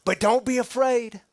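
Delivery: loud, high, urgent proclamation; an angel's breathless big news; excited announcement. Acted. Preacher opens loud, excited announcement.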